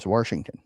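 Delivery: "Warshington" with an R before the SH; grandmother's regional pronunciation. Retold or imitated. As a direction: say Washington as "Warshington", imitating grandmother. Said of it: "when she referred to it".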